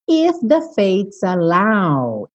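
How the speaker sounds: "If the fates allow" drawn out; slow lyric reading. Shown as a demonstration. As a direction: stretch "If the fates allow" slowly. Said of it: "lyric demo, repeated".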